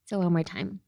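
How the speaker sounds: ordinary talk, nothing marked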